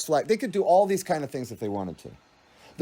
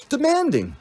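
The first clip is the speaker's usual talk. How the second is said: mock self-righteous; 9 semitones higher than usual. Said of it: "all self-righteous".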